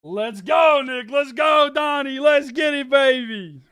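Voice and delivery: loud, high rallying shout; real excited hype; hyped cheering. Real, not acted. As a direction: holler encouragement to the crowd. Hyping up viewers by name.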